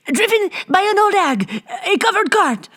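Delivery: very high, urgent gasping voice; urgent captain. A narrator's reading. Captain warns Bibot about the cart, urgent. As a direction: blurt it in alarm.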